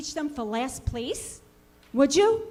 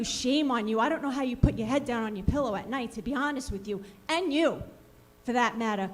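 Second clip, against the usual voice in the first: ordinary talk, nothing marked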